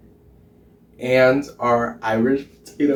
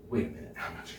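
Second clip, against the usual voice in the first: whispered aside; 4 semitones lower, 16 dB quieter, much faster than usual. Real, not acted. Rereading the recipe, muttered aside.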